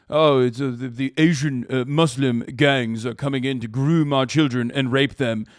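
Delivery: put-on voice of a fearmongering Tory; mock British conservative. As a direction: parody a panicked conservative. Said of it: "British conservatives will be like".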